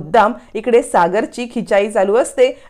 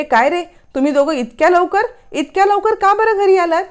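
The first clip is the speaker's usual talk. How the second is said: surprised high question; 8 semitones higher than usual. Retold or imitated. Indra surprised at early return.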